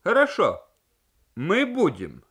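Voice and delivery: high, loud crowing agreement; rooster-like voice. Acted. Maltsen agrees on the phone, high crowing voice. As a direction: crow the reply like a rooster. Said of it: "петушиный голос Мальцена".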